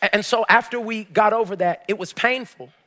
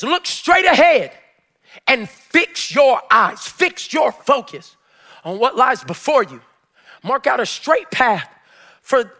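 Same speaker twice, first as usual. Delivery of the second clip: slow, loud scripture proclamation; preacher driving the verse home; full-force preaching; 4 semitones higher, 4 dB louder than usual. Real, not acted. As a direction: draw out each phrase with force.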